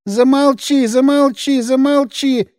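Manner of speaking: high, repeated cries to stop; a touch of frantic fear; desperate pleading. Acted. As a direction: beg him to stop, hands over ears. Albertik begs, head between knees.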